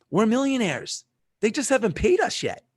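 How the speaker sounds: high-pitched, convinced retold boast; confident declaration. Acts out confident claim to partner.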